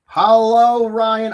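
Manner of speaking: high, loud hello; a loud, high welcome to Ryan; loud greeting. Real, not acted. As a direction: call out the hello brightly. Greeting viewer.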